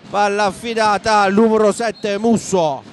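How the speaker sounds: usual speaking voice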